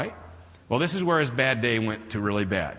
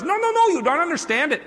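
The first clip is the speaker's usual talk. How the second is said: high, insistent worker voice; agitated hotel worker; 4 dB louder than usual. Retold or imitated. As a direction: protest in a high-pitched mock panic. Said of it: "He goes".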